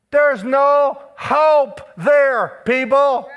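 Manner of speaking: very slow, loud and high; preaching shout. Real, not acted. Preacher warning the people there is no hope outside God, slow shout. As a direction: drag each word out as a warning.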